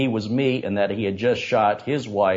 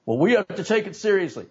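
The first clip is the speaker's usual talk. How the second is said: officer quoted; 10 semitones higher than usual. Retold or imitated. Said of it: "officer quoted again".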